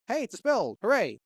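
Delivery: high-pitched mock delight; mock cheering. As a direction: play at celebrating a find. Voicing game dialogue on finding spell.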